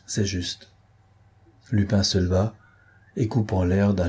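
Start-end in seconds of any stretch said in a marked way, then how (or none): none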